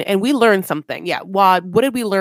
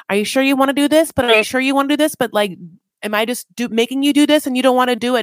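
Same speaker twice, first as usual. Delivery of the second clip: fast, pitched-up, pressing questions; anxious rapid questioning; 5 semitones higher, 4 dB louder, much faster than usual. Retold or imitated. Puppy nervously pressing Eric if he wants this, fast and high. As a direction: rattle off nervous doubts, voice raised.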